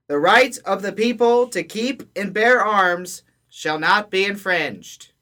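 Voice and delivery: loud, high town crier proclamation. Pretend. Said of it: "the same tone as it would have been announced by a town crier".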